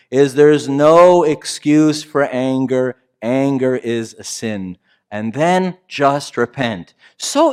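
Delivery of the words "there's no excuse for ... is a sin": slow, deliberate, "no" stressed; slow self-talk. Shown as a demonstration. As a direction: tell yourself "There's no excuse for anger", slow and firm. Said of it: "that I tell myself".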